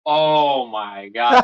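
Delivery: slow, drawn-out laughing groan; retold despair, laughed through; laughing dismay. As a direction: stretch it out with a laugh.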